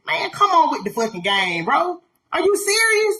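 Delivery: normal talking voice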